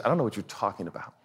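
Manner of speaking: quick, low, playing dumb; husband denies to wife, quick low innocence; feigned innocence. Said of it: "I said".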